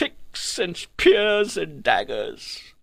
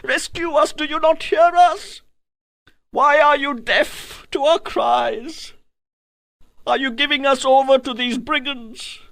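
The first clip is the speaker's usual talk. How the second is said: high, pleading cries of captive women; desperate high-pitched pleas, rising to a shout; screaming in agony; 8 semitones higher than usual. A narrator's reading. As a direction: cry out for rescue in anguish, much higher. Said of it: "They were screaming in agony".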